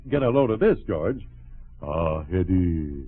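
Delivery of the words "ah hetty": slow, suave French-lover accent. Shown as a demonstration. Friend shows off his French accent to George, hammed-up. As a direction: lay on a drawn-out French accent. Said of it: "can you do a French accent?".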